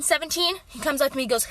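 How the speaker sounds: usual speaking voice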